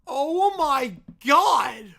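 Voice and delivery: slow, loud disbelieving oh my God; real shock; stunned. Real, not acted. Stunned by story.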